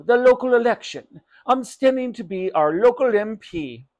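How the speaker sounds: a touch of emphatic explaining; lightly voiced mother. Mother explains the election to shopkeeper, insistent.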